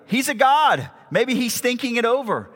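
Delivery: mock encouragement in a lifted voice; mocking taunt. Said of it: "Elijah mocked them".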